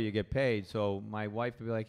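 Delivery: ordinary talk, nothing marked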